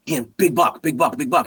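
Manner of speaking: retold excited hunting alert; urgent alert. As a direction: urgently point out the prize. Urgently alerting son to buck.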